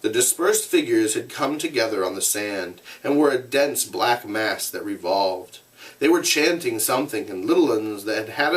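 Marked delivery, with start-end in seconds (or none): none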